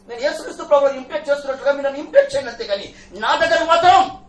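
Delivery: building sermon. Acted. Preaching build.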